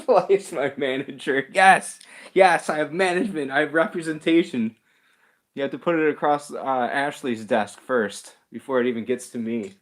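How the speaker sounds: hammed-up celebrity pride; mock proud boast. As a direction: brag about your management like a big shot. Jokingly boasting about having management.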